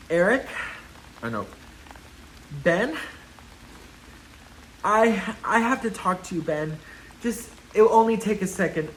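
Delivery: hesitant, pleading romantic-movie monologue; halting, earnest plea with long dramatic pauses; hammed-up last-chance love declaration to an ex; movie heroine confession. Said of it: "This is like a movie".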